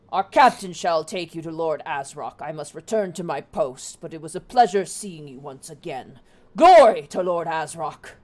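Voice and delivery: loud proud hobgoblin soldier; gravelly sly hobgoblin. Acted. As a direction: hobgoblin guard, booming salute. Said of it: "the hobgoblin turns and goes".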